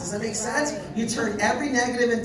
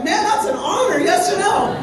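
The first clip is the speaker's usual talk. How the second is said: much higher and louder, shouting with pride; excited shout; 9 semitones higher, 10 dB louder than usual. Retold or imitated. Telling the crowd about the founder's honor, shouting with pride. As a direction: shout it out, fired up. His excited reply retold.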